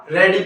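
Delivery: रेडी cut to two syllables; wrong pronunciation. Shown as a demonstration. Teacher asking whether this is right, mispronounced with two syllables. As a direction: say रेडी without the final "eye". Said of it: "इसका नहीं होगा".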